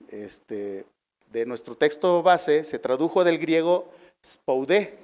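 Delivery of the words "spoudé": spoudé, slowly, stressed on the final é. Shown as a demonstration. Preacher giving the congregation the Greek word, spoudé stressed on the final E. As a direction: land the accent of spoudé on its last syllable. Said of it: "con acento en la E".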